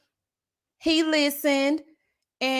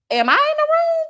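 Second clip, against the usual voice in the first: very high, louder squeal; mock swooning; 6 dB louder than usual. Reacting to a hug that felt safe, gushing squeal. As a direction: squeal it as if overcome.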